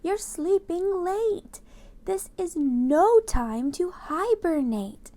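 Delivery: slow, drawn-out telling-off; mayor scolding the sleeper; scolding yell. Acted. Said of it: "he yelled".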